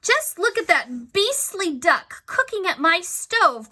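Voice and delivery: high, slow indignant wife voice; Missus Gregg crying out. A narrator's reading. Angry wife shouting about her stove. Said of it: "cried Missus Gregg".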